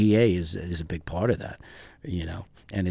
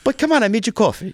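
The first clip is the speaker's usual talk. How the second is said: put-on light Greek accent, pitched higher; 14 semitones higher, 8 dB louder than usual. Greek buddy shrugging off the alligator, light Greek accent. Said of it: "my friend with the heavy Greek accent".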